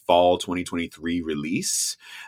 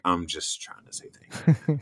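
unvoiced, breathy whisper; whispered retort; 6 dB quieter than usual. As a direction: mutter it under your breath, sheepish. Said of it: "I'm like".

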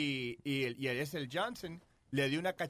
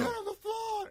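high, shouted cop order; mock cop shout; slower than usual. Cop ordering him down, mock shout. Retold police command.

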